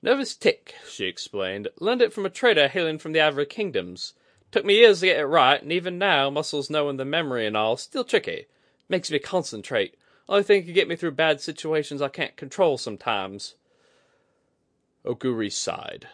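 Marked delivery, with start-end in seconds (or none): 0.0-0.7 high, bright-edged woman's voice, much higher; Kudaka voice. A narrator's reading. 1.8-13.5 high, bright-edged woman's voice, much higher; Kudaka voice. A narrator's reading.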